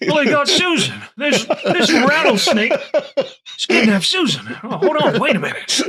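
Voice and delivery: put-on high, loud doddering old man; confused old person. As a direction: panic like a muddled old man. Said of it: "The ultimate confused old man".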